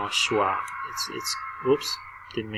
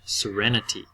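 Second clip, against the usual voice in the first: ordinary talk, nothing marked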